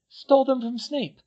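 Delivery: put-on high girlish voice, proud of her work; a clever schoolgirl boasting; Hermione. A narrator's reading. Hermione explains her still to the twins, voiced high.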